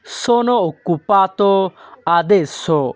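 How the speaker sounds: very slow, drawn-out words; slow model phrase. Shown as a demonstration. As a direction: teach it at learner speed. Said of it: "এই বাক্যের Italian হবে". (খুব ধীরে উচ্চারণ)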